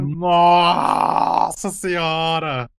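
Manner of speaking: drawn-out shocked exclamation; stunned surprise. Real, not acted. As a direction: gasp it out in slow disbelief. Shocked at the jacket price.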